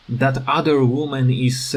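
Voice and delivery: normal talking voice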